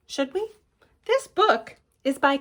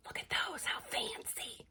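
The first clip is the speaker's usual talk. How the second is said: breathy admiring whisper; 15 dB quieter than usual. Shown as a demonstration. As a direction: whisper in delight over the socks. Admiring whisper about socks.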